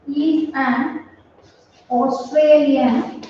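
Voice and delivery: slow, word by word, like dictating to a class; dictation pace. Shown as a demonstration. Teacher dictating an example sentence, slow. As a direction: spell it out slowly for the students to copy.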